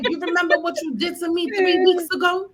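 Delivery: high accusing mock outburst; mock grudge. She voices herself dredging up an old fight, high and accusing. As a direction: throw the old grudge back, hammed up. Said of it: "And it's like".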